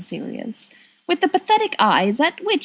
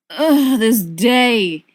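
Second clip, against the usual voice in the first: weary moan, a bit louder; exasperated groan; 2 semitones higher, 5 dB louder, much slower than usual. Acted. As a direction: let it out like a long day. Lily moans about her day.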